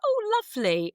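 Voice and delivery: high, gushing 'lovely'. Retold or imitated. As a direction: gush it way up high. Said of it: "And I was like".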